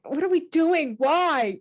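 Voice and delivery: mock whiny teenager. Teen daughter voiced in story.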